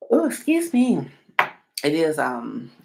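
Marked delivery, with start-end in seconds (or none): 0.1-1.1 yawning. Real, not acted. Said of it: "so that's why I'm yawning".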